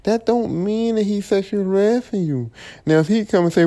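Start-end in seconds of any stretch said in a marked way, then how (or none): none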